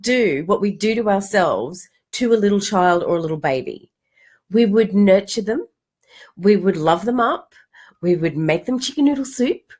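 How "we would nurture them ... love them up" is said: usual speaking voice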